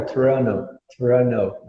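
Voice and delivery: Toronto with the second t dropped, "Toronno"; American Toronto. Shown as a demonstration. Teacher showing the student the American Toronto. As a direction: drop the last t in Toronto. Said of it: "eles tiram o T".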